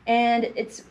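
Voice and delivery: plain reading voice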